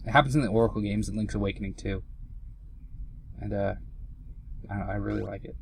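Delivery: ordinary talk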